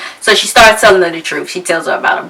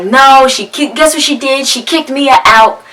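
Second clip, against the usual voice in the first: put-on tattling child; 6 semitones higher than usual. [name] tattling on her mom.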